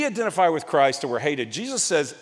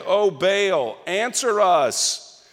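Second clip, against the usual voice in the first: calling out; 5 semitones higher, 4 dB louder, much slower than usual. Acted. Prophets calling on Baal.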